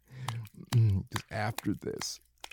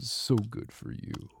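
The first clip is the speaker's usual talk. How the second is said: ordinary talk, nothing marked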